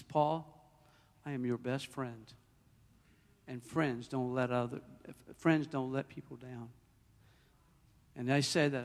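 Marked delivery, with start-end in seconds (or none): 0.0-6.7 soft, low, unhurried reassurance; comforting divine voice. Retold or imitated. The voice reassuring Paul as his best friend, hushed. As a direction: speak low and gently, pausing between phrases. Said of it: "He says".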